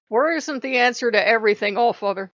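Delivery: loud, scolding protest; loud exasperated protest. Real, not acted. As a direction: push back loudly, fed up. Arguing with Odin.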